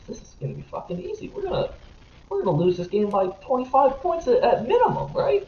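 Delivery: mock the team's hopelessness with a high fake-cheery sarcastic voice; sarcastic aside. Retelling his hopeless thoughts watching the Jets, fake-cheery sarcasm. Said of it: "and I'm like".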